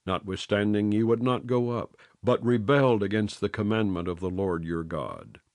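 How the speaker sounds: usual speaking voice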